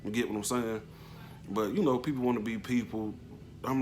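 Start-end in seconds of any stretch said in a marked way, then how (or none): none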